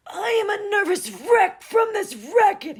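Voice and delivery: put-on very high, loud, slow old voice; shaky old shout. A narrator's reading. The old Captain complains to Gus, high slow shaky shout. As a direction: wail it like a rattled old man. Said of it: "he shouted in his shaky old voice".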